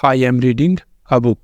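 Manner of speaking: very slow, word by word, each word set apart; slow dictation. Shown as a demonstration. Teacher dictating the correct sentence slowly to class. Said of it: "तो final जुमला होगा".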